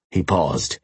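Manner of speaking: usual speaking voice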